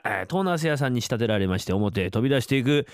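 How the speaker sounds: ordinary delivery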